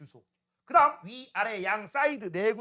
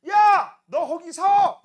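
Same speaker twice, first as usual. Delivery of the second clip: higher, louder yell to stop; shouting after him; 6 semitones higher, 10 dB louder, much slower than usual. Retold or imitated. Teacher yells at the fleeing copier thief, loud. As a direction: bark a stop command at a runner. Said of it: "이런 식으로 얘기하겠니?".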